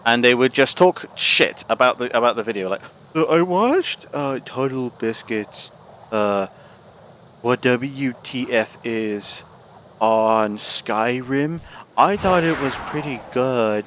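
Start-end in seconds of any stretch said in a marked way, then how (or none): 3.1-13.9 slow, airy mock reaction girl; lightly breathy, dragging each phrase. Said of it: "put on a low-cut dress with a breathy voice".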